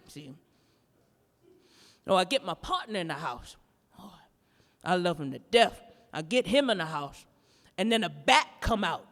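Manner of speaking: ordinary talk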